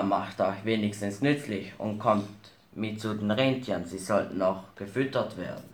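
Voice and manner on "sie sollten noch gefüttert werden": normal talking voice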